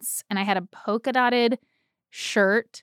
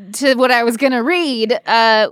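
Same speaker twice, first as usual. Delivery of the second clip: ordinary talk, nothing marked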